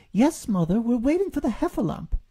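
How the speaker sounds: put-on high, loud little child voice; young Roo. A narrator's reading. Roo answers his mother from the hole, childlike. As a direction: answer brightly like a small child.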